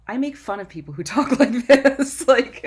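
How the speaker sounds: a sheepish laugh about her own voice; laughing at herself. Real, not acted. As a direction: laugh at your own words.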